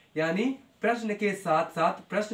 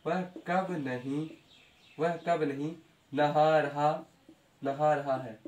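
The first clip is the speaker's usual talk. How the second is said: slow dictation; 4 semitones lower, 4 dB quieter, slower than usual. Shown as a demonstration. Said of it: "teacher drills slowly, softly".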